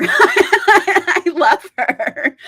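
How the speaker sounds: slow, drawn out through a laugh. Real, not acted. Streamer gushing to chat about her favourite vampire mom, laughing.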